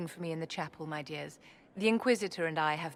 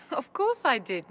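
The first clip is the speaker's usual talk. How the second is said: laughing; 6 semitones higher, 4 dB louder than usual. Acted. Amused reply to companion's surprise.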